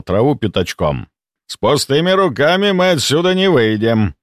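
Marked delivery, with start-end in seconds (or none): 1.5-4.1 pleased. A narrator's reading. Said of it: "с довольным видом сказал Меткий".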